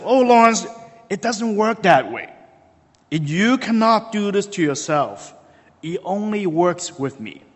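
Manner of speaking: high, mock-scolding porter; teasing insistence. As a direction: chide him playfully. Ali insists, scolding kindly.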